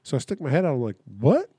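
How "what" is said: higher, louder "what?" with a rise; retold baffled reaction. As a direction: call back in mock disbelief. Reacts to being yelled at.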